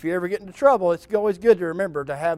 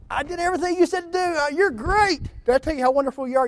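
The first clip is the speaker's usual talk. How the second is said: much higher, rushed buttering-up; mock flattery; 10 semitones higher, faster than usual. A kid sweet-talks dad after trouble, high gushing flattery. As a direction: gush like a kid dodging trouble.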